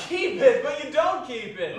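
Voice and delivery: loud, high retort; incredulous protest. Acted. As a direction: argue back loudly. Nephew protests in the quarrel.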